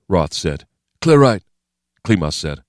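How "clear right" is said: loud, high tactical call; raised soldier's voice; military call-out. Acted. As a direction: call it out to the team. Soldier calls out a cleared hallway.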